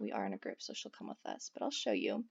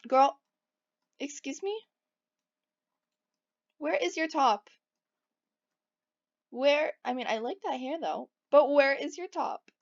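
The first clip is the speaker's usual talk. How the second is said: mock outrage; 4 semitones higher, 6 dB louder than usual. Real, not acted. Mock outrage at sim's outfit.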